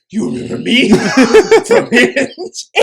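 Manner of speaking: loud, high, laughing imitation of an angry date; exaggerated loud call-out through laughter; confrontational mock yell. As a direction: blast the accusation out, cracking up. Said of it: "That's how it came off to me".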